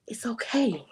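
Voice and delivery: breathy whispered reply; soft whisper. Retold or imitated. Telling the sorry boss lady it's fine, whispered. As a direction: hush it out gently.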